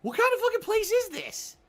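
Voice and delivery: high, loud furious retort; indignant shout; outraged yell. Retold or imitated. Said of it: "And I'm like".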